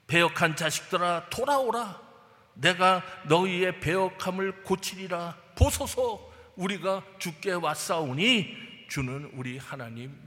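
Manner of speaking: loud, slow proclamation of scripture; preaching at full force. Acted. Preacher proclaiming Jeremiah's call to the congregation, loud and slow. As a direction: thunder the verse out to the congregation.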